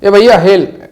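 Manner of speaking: calling out. Shown as a demonstration. Teacher demos hailing a driver, calling out loudly. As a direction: wave someone down with your voice. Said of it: "हाथ से किसी चीज़ को बुलाने को हेल कहते हैं".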